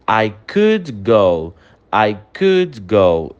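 slow, careful English, every word clear; slow English model sentence. Shown as a demonstration. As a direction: model it slowly for the class. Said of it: "O correto é dizer". (frase em inglês lenta e clara)

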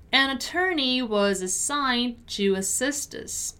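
careful, drawn-out teaching read; measured pronunciation model; slow model sentence. Shown as a demonstration. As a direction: say it slowly, word by word. Teacher models sentence slowly first.

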